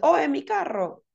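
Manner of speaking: high, put-on shocked voice; startled owner noticing the smashed car; mock surprise.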